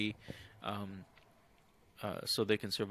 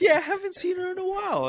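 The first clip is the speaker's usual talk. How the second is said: much higher, fast, louder put-on voice; mock high voice; 7 dB louder, faster than usual. Friend mimicking Peacemaker's awkward reply, high and fast. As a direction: rattle it off in a squeaky cartoon voice.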